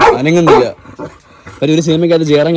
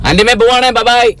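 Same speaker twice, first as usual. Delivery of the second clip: high, carrying farewell call; calling out goodbye; 8 semitones higher than usual. Real, not acted. Leaving with the dog, calling goodbye to auntie. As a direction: call a cheery bye in a raised pitch.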